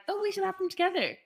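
high mock-eager suggestion; imagined keen reply. Co-host imagining her reply to admirer, high and mock-eager. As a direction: pitch it up, pretending to be keen. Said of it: "hoping that you'd be like".

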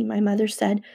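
plain reading voice

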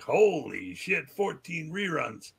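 slow, drawn-out awe; retold amazement. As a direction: stretch it out, hushed at the windfall. Retells amazement at receiving the cheque.

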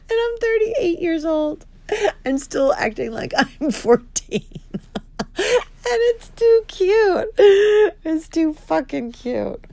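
high, squeaky laughing through the words; real giddy laughter. Real, not acted. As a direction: barely get it out for laughing. Laughing at her own crush.

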